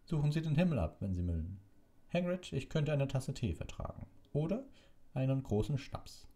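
high, breezily cheerful; quite amused. A narrator's reading. Dumbledore sending McNair to search the sky, amused. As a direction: toss it off merrily, pitched well up. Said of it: "seine Stimme klang recht vergnügt".

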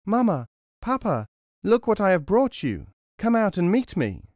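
put-on high, loud happy child call. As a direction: call home excitedly from afar. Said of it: "he called out".

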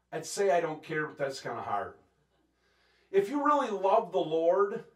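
usual speaking voice

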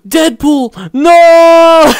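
high cry building to a long loud wail; mock-anguished scream. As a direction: scream for a fallen comrade, hammed up. Mock grief for fallen Deadpool.

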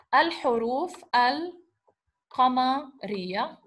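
"Al-huruf al-qamariya" very slowly, each L sounded; slow, L pronounced. Shown as a demonstration. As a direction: draw out "Al-huruf al-qamariya" in parts, keeping both Ls. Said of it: "you pronounce the L".

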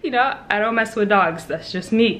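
usual speaking voice

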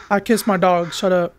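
ordinary delivery

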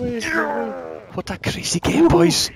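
high, loud shriek of disbelief; can't believe they won; ecstatic disbelief. Real, not acted. Celebrating the win.

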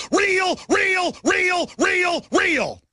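high shouted real, real, real; yelled chant of real; shouted repetition. Real, not acted. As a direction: hammer the word over and over. Hammering the word real.

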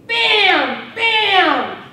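two loud, high shouted bangs; shouted gunshots. Retold or imitated. Widow acting out shooting her husband, loud gunshot shouts. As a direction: bark out the shots like a rifle.

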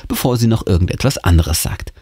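usual speaking voice

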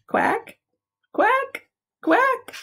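very high squeaky quacking; duck quack. Acted. Storytime for preschoolers, a very high squeaky duck quack.

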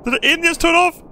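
high, loud panicked yelling; raging gamer. Real, not acted. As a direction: yell in disbelief at the screen. Engines off, shouting in panic.

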